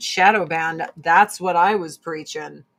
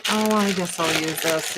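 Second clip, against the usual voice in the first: ordinary delivery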